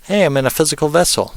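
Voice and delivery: high, excited spirit voice, fast on the second line; bright, eager ghost discovering it has a body; wandering spirit. Retold or imitated. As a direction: play a newly arrived spirit delighted to find a body. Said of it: "And it was like".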